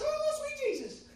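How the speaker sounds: very high, loud mock wail; high-pitched voice. Preacher imitating Sandy overcome by his answer, high-pitched wail. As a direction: wail it in put-on falsetto. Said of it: "had a real high-pitched voice".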